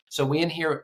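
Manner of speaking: normal talking voice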